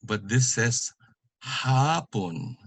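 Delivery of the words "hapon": HAPON with long HA, falling PON; first-syllable stress. Shown as a demonstration. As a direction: stretch HA in HAPON. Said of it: "You pronounce it".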